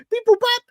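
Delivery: high, loud robotic beeping; robot beeps. Retold or imitated. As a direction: beep like a computer spitting out an answer. Mocking a rushed hiring process.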